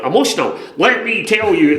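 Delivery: high, loud fired-up retort; worked-up comeback; indignant outburst. Retold or imitated. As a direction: rise up in protest. Retorting to the director.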